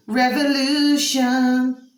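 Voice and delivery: loud, slow, high proclamation; dramatic proclamation. Real, not acted. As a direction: proclaim it slowly and grandly. Dramatic single-word pronouncement.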